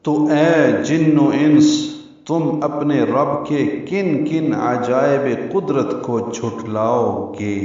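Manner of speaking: solemn formal recitation of the verse's translation; solemn translation reading. Acted. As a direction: proclaim it like scripture, slowly.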